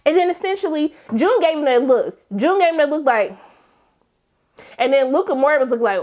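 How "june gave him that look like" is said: normal talking voice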